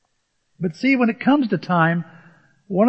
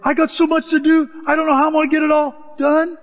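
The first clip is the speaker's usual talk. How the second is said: higher, harried voice of someone swamped; frazzled busy person; 8 semitones higher than usual. Retold or imitated. Pastor imitating an overwhelmed congregant, high and frazzled. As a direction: whine it like you're drowning in tasks. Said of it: "Maybe you've said this before".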